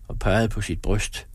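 ordinary delivery

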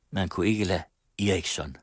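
plain reading voice

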